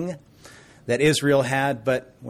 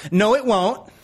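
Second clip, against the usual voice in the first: higher, louder stubborn retort; defiant child voice; 8 semitones higher, 4 dB louder than usual. Retold or imitated. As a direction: talk back like the stubborn toddler. People defying God.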